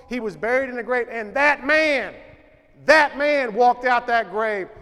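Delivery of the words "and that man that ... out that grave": slow, louder and weighty; slow building proclamation. Acted. Preacher proclaiming Jesus walked out of the grave, slow and loud. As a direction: land each word of the resurrection. Climax of the resurrection.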